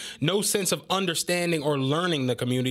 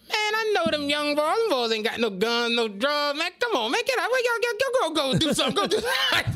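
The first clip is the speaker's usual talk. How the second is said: put-on much higher, laughing old-head cop; high, laughing impression of a cool old cop; Hospital's voice; 8 semitones higher than usual. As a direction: shoo the young boys off with a laugh. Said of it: "that's how he talked".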